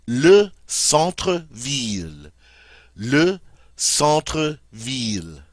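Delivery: "le centre-ville" said slowly in French, double L like an English L; slow, clear "le centre-ville" for students to repeat; "centre-ville" with the "ville" as "veel"; model French pronunciation. Shown as a demonstration. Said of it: "Notice that the double L there is pronounced like an L".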